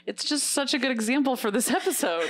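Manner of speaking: laughing. Real, not acted. Laughing at her own joke.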